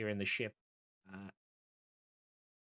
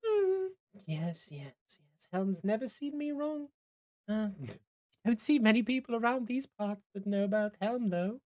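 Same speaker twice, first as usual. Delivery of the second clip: much higher, dreamy devotee; devout woman character; 11 semitones higher, 3 dB louder than usual. Acted. Woman in the hammock answers a passenger about Helm, high character voice. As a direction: lift the voice high for a quaint believer.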